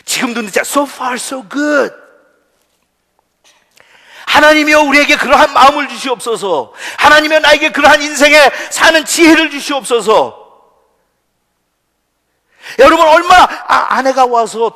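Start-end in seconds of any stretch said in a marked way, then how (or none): 4.3-6.7 loud, high pleading cry to God; fervent shouted prayer. Real, not acted. Preacher praying loudly before the congregation, shouted plea. 7.0-10.4 loud, high pleading cry to God; fervent shouted prayer. Real, not acted. Preacher praying loudly before the congregation, shouted plea.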